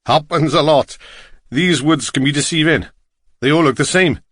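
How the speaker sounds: lilting Welsh cabbie; Welsh accent. A narrator's reading. Driver talks to lost walker about the woods, Welsh accent. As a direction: speak as a chatty Welsh driver. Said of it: "his strong Welsh accent".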